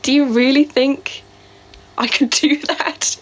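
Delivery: mock alarm at a dare; incredulous retort. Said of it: "and I was like".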